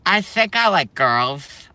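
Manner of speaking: high, slow, timid mock voice, rising at the end; mocking soy boy. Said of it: "that look like this and they go".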